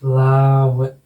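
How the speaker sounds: फ्लावर drawn out, long "laa", no final R; slowly modelled "flaa-we" for फ्लावर; sound-by-sound demo. Shown as a demonstration. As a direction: stretch फ्लावर to show each sound. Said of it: "ये आपकी ध्वनि आ रही है".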